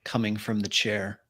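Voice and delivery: ordinary talk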